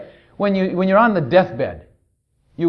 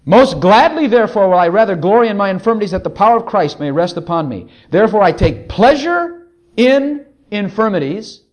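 forceful declamation, slowing on the last phrase; scripture reading; 5 dB louder than usual. Acted. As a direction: proclaim the verse from the pulpit. Reads the verse out again.

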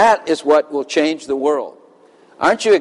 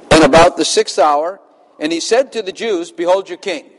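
scripture reading; 4 semitones higher than usual. Acted. Reads scripture aloud.

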